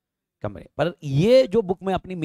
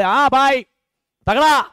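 hearty mock approval, louder and higher; teacher voicing the president signing off; president approving; 7 semitones higher, 7 dB louder, slower than usual. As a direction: play the president giving a big yes. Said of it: "बोले".

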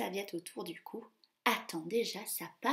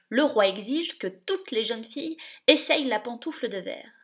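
louder official announcement; firm duke; 8 dB louder than usual. Acted. Grand duke to the stepmother, firm. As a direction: state the king's order. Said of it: "intervient le grand-duc".

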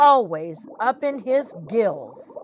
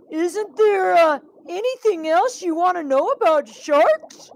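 high, loudish nervous fish voice; desperate stalling; 8 semitones higher, 3 dB louder than usual. Acted. Birdie thinks fast to delay the shark, high nervous plea. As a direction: scramble for time, pitched high.

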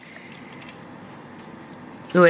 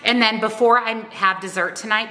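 normal talking voice

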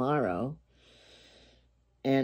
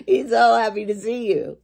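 bright burst, much higher and louder; overjoyed dog greeting its owner, higher and louder; happy dog; 8 semitones higher, 7 dB louder than usual. Acted. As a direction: play the dog's pure delight. Dog's joy in joke punchline.

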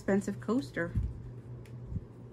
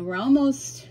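ordinary talk

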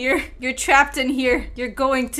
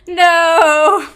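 loud no; 4 semitones higher, 11 dB louder than usual. Pretend. Pretend couple quarrel.

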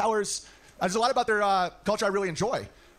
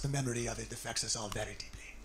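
low, soft solemn voice; mock her grave tone, dropped low and soft; low, hushed mock of a grave friend; 9 semitones lower, 12 dB quieter than usual. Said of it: "She was like".

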